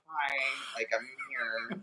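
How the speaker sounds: sheepish greeting. Retold or imitated. Teen showing up with court paperwork, softly sheepish. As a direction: say it small, a little embarrassed.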